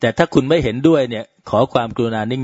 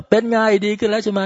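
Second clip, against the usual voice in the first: higher, bright smiling greeting; cheerful doctor; 9 semitones higher than usual. Retold or imitated. Doctor cheerfully greets the mother, voiced higher and smiling. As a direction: beam as you ask if it's better. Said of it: "คุณหมอก็ยิ้มแย้มแจ่มใสว่า".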